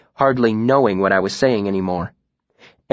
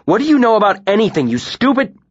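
loud, high, fast furious outburst; fast angry yell, bitten off; 11 semitones higher, 4 dB louder than usual. A narrator's reading. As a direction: lash out before catching himself. Furious quarrel, cut off mid-insult.

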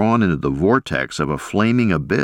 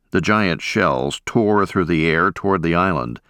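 ordinary delivery, nothing marked

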